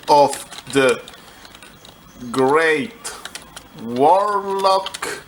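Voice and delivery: slow, lightly ominous title read; mock sinister title. Shown as a demonstration. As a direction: intone it like a grim album name. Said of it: "something vaguely evil or satanic or magical".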